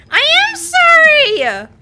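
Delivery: slow, very loud, high sorry; loud apology. Real, not acted. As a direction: shout the apology out.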